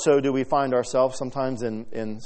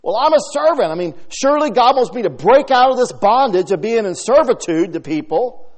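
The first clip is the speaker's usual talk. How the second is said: mock protest; 10 semitones higher, 11 dB louder than usual.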